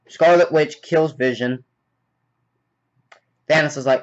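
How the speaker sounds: ordinary talk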